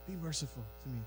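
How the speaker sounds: soft, understated plea to God; hushed, humble little prayer; private prayer. Acted. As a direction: pray it gently, as if all alone. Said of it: "in a room where no one can see him".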